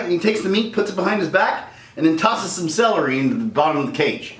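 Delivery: ordinary delivery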